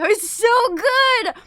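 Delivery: drawn-out loud praise; slow delighted shout. Real, not acted. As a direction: shout how good it is, slowly. Eating crackers.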